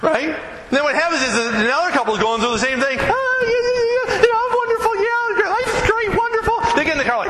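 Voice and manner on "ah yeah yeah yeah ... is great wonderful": much higher, sugary fake cheer; fake cheerful. Preacher mocking a churchgoer faking happiness, much higher and sugary. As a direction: pretend everything is perfect.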